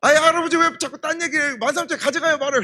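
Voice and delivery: high, loud frustrated demand; exasperated customer. Retold or imitated. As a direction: snap at the old man to decide. Exasperated buyer demanding answer.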